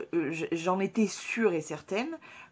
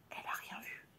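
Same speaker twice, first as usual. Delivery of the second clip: whispering; 16 dB quieter than usual. Real, not acted. Whispered aside in story.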